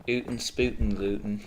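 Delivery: a light mock Baltimore accent; goofy half-hearted Baltimore accent; a hint of a Baltimore accent, playing with the sounds. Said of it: "Imagine if you had a Baltimore accent".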